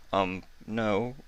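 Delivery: meek, trailing away; sheepish. A narrator's reading. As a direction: answer sheepishly, afraid of her. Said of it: "Tucker said sheepishly".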